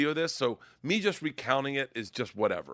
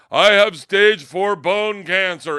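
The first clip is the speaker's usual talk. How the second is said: slow, loud, higher proclamation; drawn-out, loud audience-member voice; front-row announcer; 5 semitones higher, 11 dB louder, much slower than usual. Retold or imitated. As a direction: declare it slowly to the whole room. Front-row man announces illness, acted.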